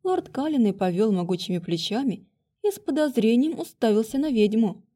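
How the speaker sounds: usual speaking voice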